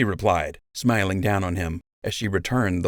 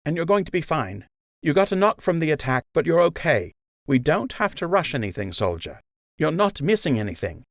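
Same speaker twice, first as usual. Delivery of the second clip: higher, kind, reassuring healer's voice; nurse Emma; 9 semitones higher than usual. Acted. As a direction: soothe the wounded soldier gently. Emma speaks to patient Joe.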